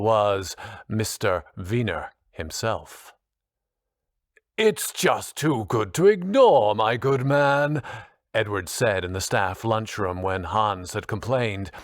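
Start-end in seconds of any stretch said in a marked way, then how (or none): 4.6-7.8 higher, drawn-out posh British drawl; drawling posh accent. A narrator's reading. Posh teacher brushing off colleague's complaint in staff lunchroom, drawling. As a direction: play the pompous posh colleague, louder and higher. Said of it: "that drawling, posh accent".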